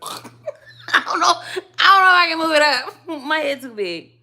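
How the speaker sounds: high, loud laughter breaking through the words; laughing. Real, not acted. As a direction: giggle helplessly at your own fumbling. Fumbling with the iPad on camera.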